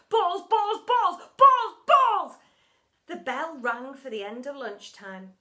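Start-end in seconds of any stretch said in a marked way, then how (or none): 0.1-2.4 loud, high, crazed chant; gone bananas. A narrator's reading. Teacher rolling around obsessed with balls, crazed chanting. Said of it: "as if he'd gone bananas".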